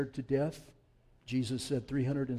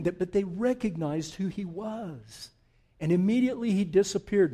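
full-force preaching; 4 semitones higher, 7 dB louder than usual. Acted. Preaches the Emmaus story at full force.

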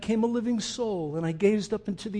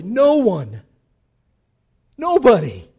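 emphatic shout; 3 semitones higher, 10 dB louder than usual. Acted. Preacher's loud emphatic climax.

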